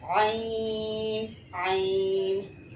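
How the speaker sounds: आइन held very long; slow letter recitation. Shown as a demonstration. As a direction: stretch आइन out for the class. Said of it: "जैसे". (आइन को बहुत खींचकर)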